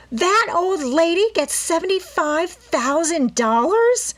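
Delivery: spoiled daughter outraged at another's inheritance; harsh demand. A narrator's reading. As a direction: shriek the question in spiteful disbelief. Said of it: "Ada Topham demanded harshly".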